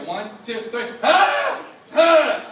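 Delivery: counting down, then a sudden high yelp; brace, count, then yelp at the scalding water; shower yelp. Retold or imitated. Kid counting down before the hot shower, yelping.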